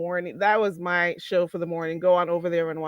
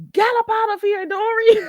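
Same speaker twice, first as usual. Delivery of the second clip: laughing mock dismissal; 13 semitones higher, 5 dB louder than usual. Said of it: "I'm not even laughing like that".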